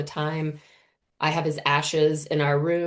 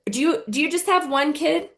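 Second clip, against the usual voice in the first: put-on pitched-up curious girl, lightly done; 9 semitones higher than usual. Girl on the playground asking mom, lightly childlike. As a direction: ask it like a nosy kid. Nine-year-old girl voiced.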